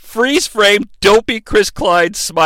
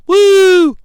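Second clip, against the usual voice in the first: mock cheer; 12 semitones higher, 4 dB louder than usual. Cheer after fake fan page.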